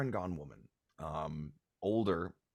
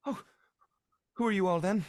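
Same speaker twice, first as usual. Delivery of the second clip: high, loud surprised question; startled mother; 13 semitones higher, 8 dB louder, much faster than usual. Acted. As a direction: notice strangers all of a sudden. Startled mother notices the strangers.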